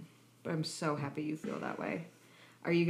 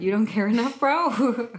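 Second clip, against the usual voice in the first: louder, higher teasing with a laugh; teasing jab; 6 semitones higher, 10 dB louder than usual. Real, not acted. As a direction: needle her playfully. Teasing friend about the movie.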